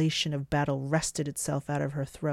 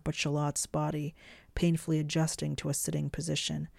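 plain reading voice

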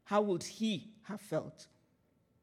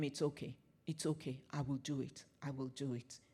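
softer and lower, gently reassuring; calm him down quietly, it's fine; 5 semitones lower, 11 dB quieter than usual. Retold or imitated. Reassuring the man who needed help.

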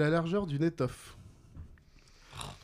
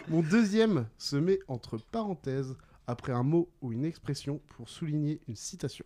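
measured riddle reading. Real, not acted. Quizmaster gives players the second clue, deliberately. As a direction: space out each phrase for the players.

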